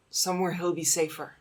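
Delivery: normal talking voice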